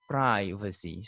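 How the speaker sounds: privacy as "PRY-vacy", slow and low; eye diphthong. Shown as a demonstration. Teacher repeating the American form, privacy as "PRY-vacy". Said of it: "I diphthong is there".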